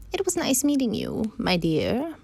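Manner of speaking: high, sliding wolf farewell; story wolf. Acted. Wolf says goodbye to the girl, high swooping coaxing. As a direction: sweet-talk her, starting high.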